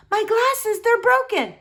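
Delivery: high, loud dismay; distressed wail. Acted. Boy wailing over his broken glasses. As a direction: voice climbing in panic.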